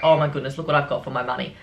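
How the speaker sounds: ordinary talk, nothing marked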